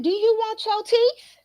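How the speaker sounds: high, sharp mock threat; threatening mom. Mother threatening her mouthy son, high mock menace. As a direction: threaten him like a fed-up mom. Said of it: "I said".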